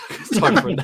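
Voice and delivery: winded, laughing and quick; high, puffed-out chuckling delivery; out of breath. Real, not acted. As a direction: laugh through it, short of breath. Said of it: "You sound out of breath".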